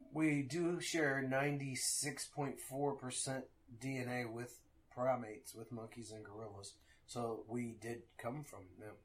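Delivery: slow, soft and low, trailing down; quiet measured correction. Retold or imitated. Parent correcting another parent on evolution, quietly measured. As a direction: imitate someone gently laying out facts.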